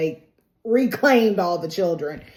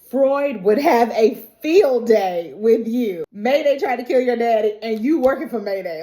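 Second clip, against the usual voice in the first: raised, louder mock-astonished scolding; incredulous ribbing; 4 semitones higher, 4 dB louder than usual. [name] is astonished at Garth.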